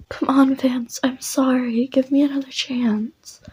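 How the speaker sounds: put-on high, breathy pleading ex-girlfriend voice; pleading girl.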